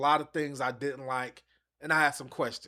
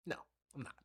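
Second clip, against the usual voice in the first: a sudden whispered aside, much softer; 12 dB quieter, much faster than usual. Real, not acted. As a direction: drop to a breathy whisper for the answer. Whispered aside after own question.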